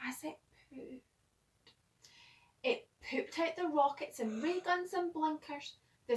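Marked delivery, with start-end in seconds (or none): 0.1-1.0 hushed, secret telling; whispered narration. A narrator's reading. Narrator whispering the naughty part to the young listener.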